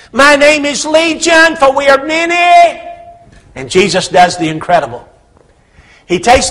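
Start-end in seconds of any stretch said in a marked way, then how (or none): 0.1-2.8 high, loud, slow voice of many demons. Acted. Demons answering Jesus' question, loud and high. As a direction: boom it out as the demon horde.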